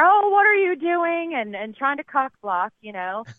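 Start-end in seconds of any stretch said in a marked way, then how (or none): 0.0-1.3 high, loud, drawn-out interruption; exaggerated high-pitched busybody question; mock meddling friend. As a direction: butt in loudly as the interfering buddy. Imitating friend jumping in to interfere.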